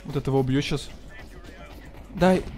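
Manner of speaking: ordinary delivery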